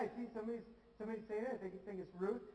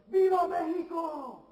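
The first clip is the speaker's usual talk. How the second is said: a big rally shout, much higher and louder; yell; 9 semitones higher, 13 dB louder, slower than usual. Retold or imitated. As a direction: shout Viva Mexico like a governor to the crowd. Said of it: "and he'd yell".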